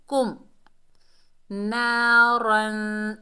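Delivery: slow drawn-out Naran; Quran recitation. Shown as a demonstration. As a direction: stretch the first ा one beat. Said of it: "एक अलिफ की मिकदार खींच कर पढ़ेंगे ना".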